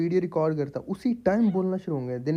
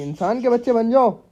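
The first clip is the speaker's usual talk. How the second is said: higher, louder telling-off; scolding shout; 6 semitones higher, 5 dB louder than usual. Real, not acted. Yelling at his chattering parrots to behave, loud scolding. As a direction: bark the order at the birds, loud.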